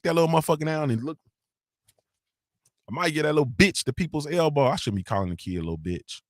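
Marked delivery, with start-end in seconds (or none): none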